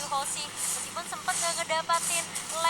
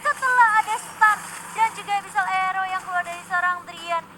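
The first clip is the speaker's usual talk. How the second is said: play-by-play; 4 semitones higher, 7 dB louder than usual. Real, not acted. Fight play-by-play.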